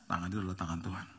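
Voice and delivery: breathy preacher's hush on the key line; whisper. Acted. Preacher whispering to the congregation about God's hand. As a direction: drop to an intimate whisper.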